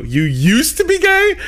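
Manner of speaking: high, slow incredulous question. Retold or imitated. Telling his host, his own stunned reply, high and slow. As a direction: stretch it out in disbelief.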